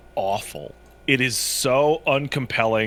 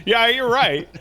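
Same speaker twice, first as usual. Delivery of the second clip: laughing; 9 semitones higher, 3 dB louder than usual. Real, not acted. Laughing agreement with co-host.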